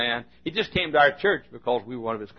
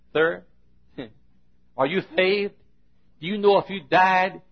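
higher-pitched lisping voice; imitated speech impediment; 8 semitones higher, 7 dB louder than usual. Church nut asking banker if he's saved, lisping higher voice. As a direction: mimic a lisping man earnestly witnessing. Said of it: "he, he, he, he talked like this".

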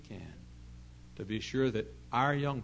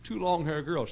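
laughing; 6 semitones higher, 6 dB louder than usual. Real, not acted. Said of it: "I'm sorry to laugh".

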